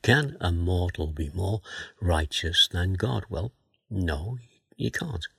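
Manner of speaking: usual speaking voice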